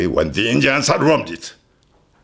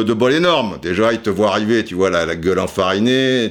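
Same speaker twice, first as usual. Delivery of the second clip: ordinary talk, nothing marked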